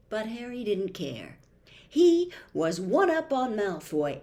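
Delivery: usual speaking voice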